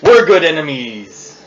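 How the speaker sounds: put-on pirate accent. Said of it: "the pirate slang".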